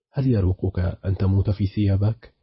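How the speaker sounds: plain reading voice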